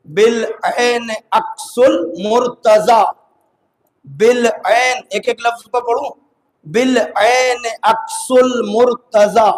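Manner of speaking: slow, measured, word-by-word recital; verse recitation. Real, not acted. Preacher reciting a verse word by word, slow and measured. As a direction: recite each word deliberately, spaced out.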